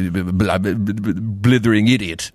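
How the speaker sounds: bumbling British stammer, low-pitched; Hugh Grant. Shown as a demonstration. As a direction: stutter helplessly like a dithering Englishman. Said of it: "the Hugh Grant sort of".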